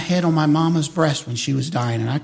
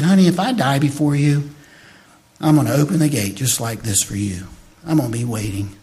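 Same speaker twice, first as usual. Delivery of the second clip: tender promise; 7 semitones lower, 4 dB quieter than usual. Retold or imitated. Tender words to wife.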